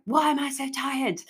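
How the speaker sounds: high, exasperated self-questioning; frustrated. Retold or imitated. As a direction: wail it mildly, like scolding yourself. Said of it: "I'd be really frustrated at myself".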